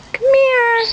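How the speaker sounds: high, sing-song here kitty; calling the cat. Real, not acted.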